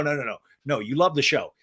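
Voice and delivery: ordinary talk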